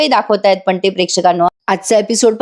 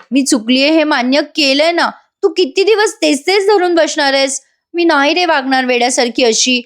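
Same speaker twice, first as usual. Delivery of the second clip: higher-pitched, earnest plea; pleading wife; 5 semitones higher than usual. Retold or imitated. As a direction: plead with a husband to relent. Acted wife pleading with husband.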